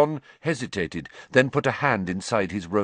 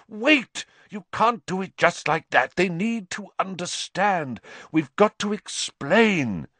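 urgent plea; 11 semitones higher than usual. A narrator's reading. Lupin struggling, pleading urgently.

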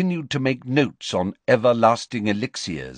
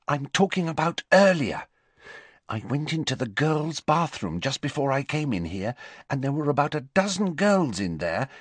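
higher, urgent schoolgirl voice; earnest Hermione; 7 semitones higher than usual. A narrator's reading. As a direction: warn him, serious and brisk. Hermione warning Harry.

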